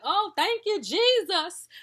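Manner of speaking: high, loud hammed-up church praise; loud mocking cry of thanks to Jesus; mock dramatic praise. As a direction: mimic over-the-top thankful gushing. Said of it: "why do people be so dramatic".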